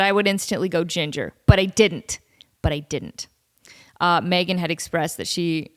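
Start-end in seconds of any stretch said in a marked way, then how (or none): 2.6-3.3 breathy whispered repeat, dropped low; whispered aside. Real, not acted. Host whispering a repeat to listeners, playful aside. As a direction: whisper it to yourself like a private joke.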